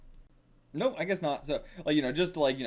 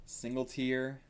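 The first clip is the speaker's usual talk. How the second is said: ordinary delivery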